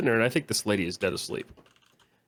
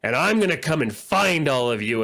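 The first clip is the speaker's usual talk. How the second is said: threatening rant; 5 semitones higher than usual. Retold or imitated. Patient threatening the bank rep, hammed-up menace. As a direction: promise revenge down the phone. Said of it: "this lady was just like".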